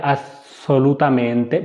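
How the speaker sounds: assolutamente said slowly, Italian style, every syllable clear; Italian pronunciation. Shown as a demonstration. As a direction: stretch out assolutamente carefully in Italian. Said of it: "assolutamente आपने लिखा हुआ कहीं पढ़ना है". (assolutamente इतालवी उच्चारण में धीरे-धीरे)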